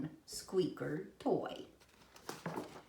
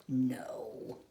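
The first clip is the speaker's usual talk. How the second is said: ordinary delivery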